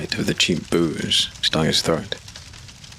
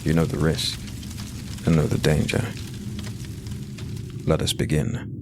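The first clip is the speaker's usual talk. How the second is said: eerie host intro; 4 dB quieter than usual. A narrator's reading. Host greeting the reader, ominous and hushed.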